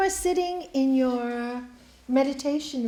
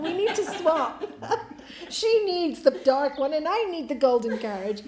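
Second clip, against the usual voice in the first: laughing plea; 3 semitones higher, 3 dB louder than usual. Acted. Monk begs to swap, laughing.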